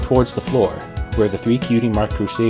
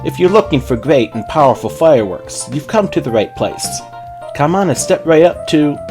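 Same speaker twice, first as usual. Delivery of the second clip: high, loud showman's pitch; show pony patter; 6 semitones higher, 6 dB louder than usual. A narrator's reading. Trixie pitching fireworks to a customer, show pony mode. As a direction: roll up like a fairground barker. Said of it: "got back into show pony mode".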